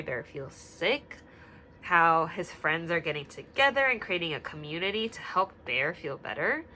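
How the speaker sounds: ordinary delivery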